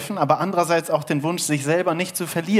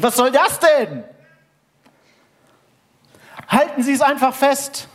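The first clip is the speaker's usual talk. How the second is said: hoch und laut, voller Entsetzen; panischer Schrei; 11 semitones higher, 6 dB louder than usual. Acted. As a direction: schrei entsetzt zur Brücke runter. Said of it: "Und er schrie". (Schrei, hoch und laut)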